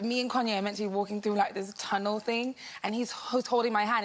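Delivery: normal talking voice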